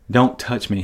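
ordinary talk, nothing marked

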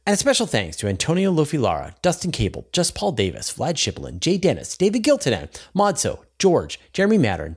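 upbeat sponsor read; podcast outro read. Acted. Newsletter and Patreon outro read.

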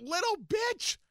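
high sneering taunt; mocking taunt. Real, not acted. Repeating the taunt louder.

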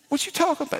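high, squeaky disbelief; shocked kid. Retold or imitated. Son reacting to dad's bed claim, high and shocked. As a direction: squeak it out in outrage.

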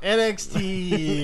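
slow, stretched announcer call; drawn out. Pretend. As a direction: drag out each letter. Drawn-out show name.

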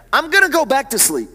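ordinary talk, nothing marked

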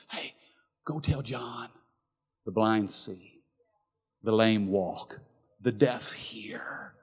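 soft and slow, fading to a whisper; gentle reply. Acted. Jesus sending word back to doubting John, soft reassurance. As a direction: reassure him quietly.

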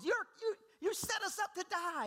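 high-pitched mock outrage; accusing complaint. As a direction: whine an accusation, playing the grumblers. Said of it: "This is what they're telling Moses".

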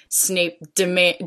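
ordinary delivery

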